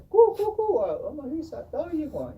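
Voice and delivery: loud, very high hooting coos; shrill-free, high kachina coo sung out to the clowns; kachina hooting call. Retold or imitated. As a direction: call out like a hooting spirit being. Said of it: "they go up to them and say".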